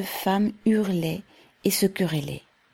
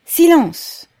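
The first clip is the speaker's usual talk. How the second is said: much higher, much louder command; shouted silence; 11 semitones higher, 9 dB louder than usual. A narrator's reading. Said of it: "cria-t-on".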